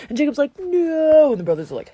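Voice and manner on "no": mock anguish. Jacob told his son died.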